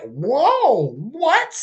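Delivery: high, stunned whoa what; scandalized high-pitched whoa; shocked disbelief. Retold or imitated. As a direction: pitch it up like you can't believe it. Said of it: "I'm holding my pearls".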